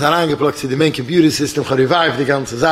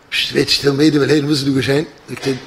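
ordinary delivery

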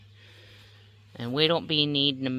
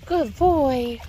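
higher and louder praise; 10 semitones higher, 5 dB louder than usual. Real, not acted. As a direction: cheer the dog on up high. Praising dog in water.

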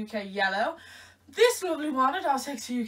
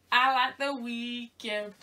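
slow; slower than usual. Real, not acted. Slow drawn statement, repeated.

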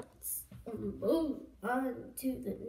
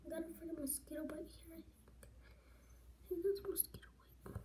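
fast unvoiced whisper; 3 semitones higher, 12 dB quieter, faster than usual. Real, not acted. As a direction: hush it quickly. Whispering through routine.

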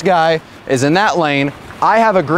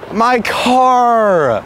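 drawn-out lament, pitched up; real upset wail; close to crying; 4 semitones higher than usual. Real, not acted. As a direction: mourn it, stretching the words. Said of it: "I'm actually gonna cry".

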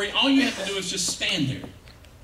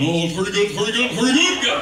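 dummy voice; 4 dB louder than usual. Acted. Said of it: "he might sound a little something like this".

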